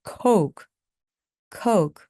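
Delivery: "coke" as a model word with a gliding "ou"; full "oh" diphthong. Shown as a demonstration. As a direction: let the vowel in "coke" glide from "oh" to "oo". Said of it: "어우 발음을 반드시 내주시기". ("coke"의 '오우' 발음을 또렷하게)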